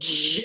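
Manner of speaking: buzzing machine zap; imitated buzz. Describing the facial laser treatment, imitated buzz. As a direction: make the device's zzz noise.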